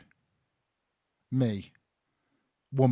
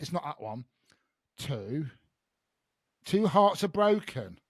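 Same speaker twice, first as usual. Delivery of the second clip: ordinary talk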